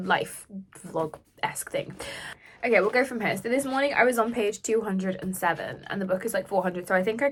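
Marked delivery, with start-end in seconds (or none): 0.1-1.9 slow, low whispered afterthought; whispered self-correction; whispered aside. Real, not acted. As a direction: breathe it out as a throwaway. Trailing aside about video name.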